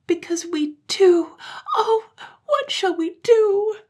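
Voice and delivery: put-on high, slow, wailing tearful children; crying together. Children crying to mother together, high and wailing. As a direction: wail it in tears. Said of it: "they cried together".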